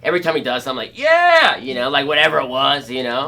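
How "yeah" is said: loud high yeah; excited cheer. Retold or imitated. Cheering the older skater's tricks, loud and high.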